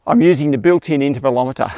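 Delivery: ordinary talk, nothing marked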